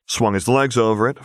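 usual speaking voice

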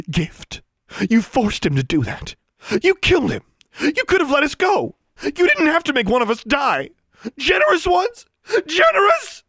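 outraged accusation climbing very high and loud; rising to a shriek. A narrator's reading. Alexandra accusing elves of killing him, rising shriek. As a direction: start high and build to a screeching pitch. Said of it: "Her voice rose to a shriek".

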